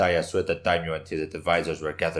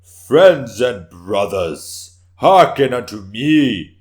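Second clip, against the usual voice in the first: loud, slow, booming call to the hall; a warrior rallying the assembled court, booming slowly; belted out; 3 semitones higher, 12 dB louder, much slower than usual. Acted. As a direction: belt it out to the whole council. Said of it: "he belted out".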